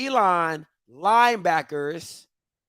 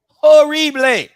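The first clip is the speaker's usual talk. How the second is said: Horrible stretched slow, higher and louder; drawn-out disgust; 7 semitones higher, 11 dB louder, slower than usual. Real, not acted.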